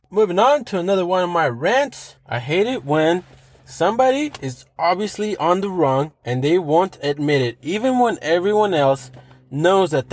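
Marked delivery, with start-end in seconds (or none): none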